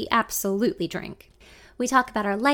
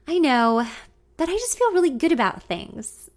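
confident; 5 semitones higher, 4 dB louder than usual. A narrator's reading. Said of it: "I say, shifting confidently".

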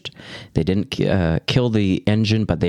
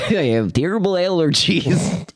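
higher, put-on radio voice with a cold; stuffy announcer voice; 10 semitones higher than usual. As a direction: say it like a congested DJ. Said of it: "like, a cold or something?".

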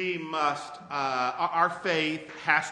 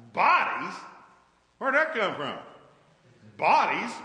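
mock surprise; 2 semitones higher, 7 dB louder, slower than usual. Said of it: "rhetorical incredulity".

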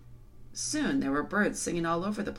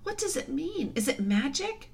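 put-on very high, bewildered girl's voice; high, upset questioning; distressed cry; 11 semitones higher than usual. A narrator's reading. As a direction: plead for an answer. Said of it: "cried Susan".

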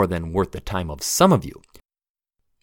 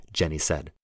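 plain reading voice

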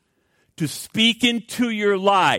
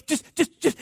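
pleading; 9 semitones higher than usual. Retold or imitated. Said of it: "he's, like, pleading, he's groveling".

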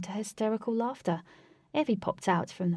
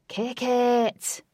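higher, loud, drawn-out yell; shouted cue; 5 semitones higher, 6 dB louder than usual. A narrator's reading. Man cueing a rock band, shouting. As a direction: holler it to start the band.

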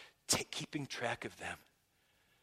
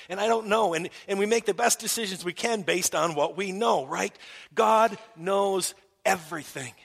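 loud, higher, emphatic preaching; 6 semitones higher, 10 dB louder than usual. Real, not acted. Pastor proclaiming God's knowledge to the congregation, loud. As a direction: proclaim it to the back row.

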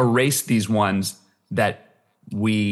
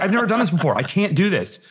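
surprised protest; 7 semitones higher than usual. Retold or imitated. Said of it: "I was totally surprised".

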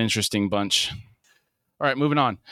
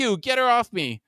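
high-pitched acted plea for help; desperate yell; 11 semitones higher than usual. A narrator's reading. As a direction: yell to get an attacker pulled off you. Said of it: "Huey yells".

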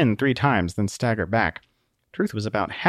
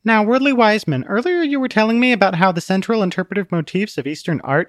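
higher, polite and eager; prim lady voice; 8 semitones higher than usual. A narrator's reading. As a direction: turn back to her date. Truth resumes date.

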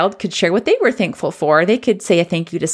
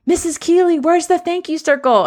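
higher, eager little student asking teacher; imitating a child; 8 semitones higher than usual. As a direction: play the kid tugging at teacher's sleeve. Student asks teacher about circle.

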